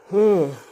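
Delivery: a long, loud, high yawn; big yawn. Real, not acted. Tired from scrolling Google, a loud real yawn. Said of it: "I'm just yawning from thinking".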